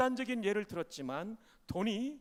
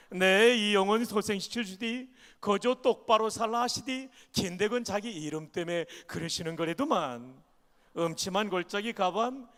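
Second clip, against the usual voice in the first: Pyongan dialect; 6 dB louder than usual. Shown as a demonstration. Pastor reads Psalm 23 to the church in Pyongan dialect. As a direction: read it like a northern Pyongan elder. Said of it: "평안도 버전입니다".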